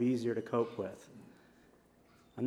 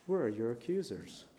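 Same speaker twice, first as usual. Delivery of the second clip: softer, quiet mercy; gentle Jesus; 4 semitones lower, 5 dB quieter than usual. Acted. Jesus quietly forgiving the woman caught in adultery. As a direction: let her off gently and kindly.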